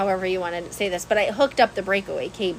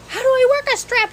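panicked; 6 dB louder than usual. Retold or imitated. Panicked plea to friend on phone.

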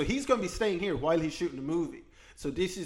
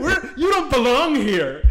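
mock hostile shout; 8 semitones higher, 6 dB louder, slower than usual. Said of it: "mocking hostile locals".

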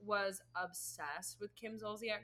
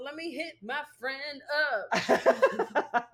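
laughing; 4 semitones higher, 10 dB louder, much slower than usual. Real, not acted. Laughing slow playful line.

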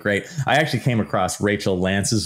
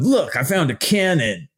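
higher, eager little discovery cry; mock excited finder; 7 semitones higher than usual. Mocking a finder to podcast listeners, eagerly. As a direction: play the proud finder showing off.

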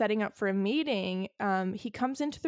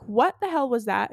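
louder, mildly angry confrontation; mock angry demand; 3 semitones higher, 8 dB louder than usual. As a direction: storm in and demand it, a bit louder. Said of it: "so mad".

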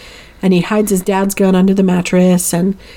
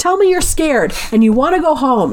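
higher-pitched mock yell; retold yelling; 6 semitones higher than usual. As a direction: imitate a desperate demand at restrained volume. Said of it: "yelling at her all of these things".

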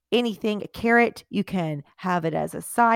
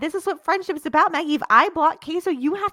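mock desperate; 10 semitones higher, 5 dB louder than usual. Mock pleading with friend.

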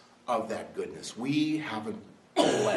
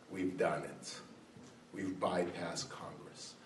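low, hushed quote of insiders; behind-closed-doors confiding; 6 semitones lower, 10 dB quieter than usual. Acted. Officials gloating behind closed doors, low and hushed. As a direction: drop low and soft, as among insiders. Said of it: "celebration behind closed doors".

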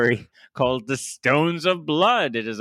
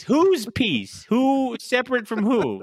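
higher puzzled questions; mock confusion; 11 semitones higher, slower than usual. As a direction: play a baffled reader.

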